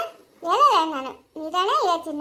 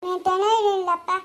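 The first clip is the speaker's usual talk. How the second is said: ordinary talk, nothing marked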